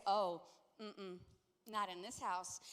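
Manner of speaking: low, decisive mom's veto; firm refusal. Retold or imitated. Mom refusing son a headset, firmly. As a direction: shut it down, no debate. Voices herself refusing son's request.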